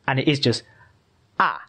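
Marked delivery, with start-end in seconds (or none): 1.4-1.6 "ah" falling straight down; fourth tone. Shown as a demonstration. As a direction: drop "ah" sharply downward. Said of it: "it's just going straight down".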